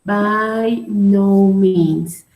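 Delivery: by no means, each word stretched out slowly; slow drawn-out. Shown as a demonstration. Teacher to students, drawing out the answer slowly. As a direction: spell out by no means slowly for the class. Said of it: "so what was the expression?".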